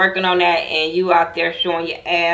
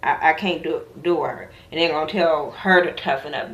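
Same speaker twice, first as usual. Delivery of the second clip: ordinary delivery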